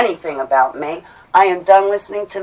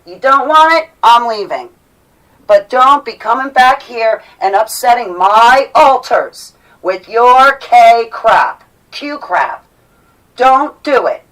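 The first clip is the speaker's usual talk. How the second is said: real angry outburst; 4 semitones higher, 6 dB louder, slower than usual. Real, not acted. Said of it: "I lost it".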